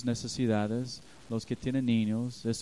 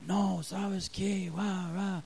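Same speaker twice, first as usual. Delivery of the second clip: mock angry husband; 7 semitones higher, much slower than usual. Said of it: "ahí estoy hablando recio".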